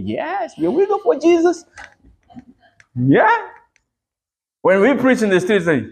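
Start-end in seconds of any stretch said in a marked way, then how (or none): 0.0-3.5 high-pitched, proud comeback to mockers; defiant cheerful retort. Preacher answering those who call believers weirdos, gleefully defiant.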